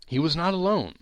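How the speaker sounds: plain reading voice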